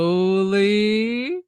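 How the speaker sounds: one long, teasing, sliding word; drawn-out slowly. Retold or imitated. Wrestler retelling how she stuck her toe out, drawn-out slowly. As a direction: stretch "slowly" out long with a rising glide.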